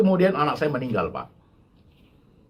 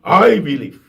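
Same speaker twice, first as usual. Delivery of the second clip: a sure, firm "I believe" in English, noticeably louder; earnest believer; 6 dB louder, slower than usual. Shown as a demonstration. Preacher showing the congregation how to declare faith, firm and sure. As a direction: say it with real certainty. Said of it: "baru kita ngomong".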